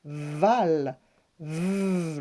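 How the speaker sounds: "val" stretched, then "v" as a long buzz; isolating sounds. Shown as a demonstration. Teacher breaking down the second syllable, drawn-out sounds. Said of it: "ensuite on entend".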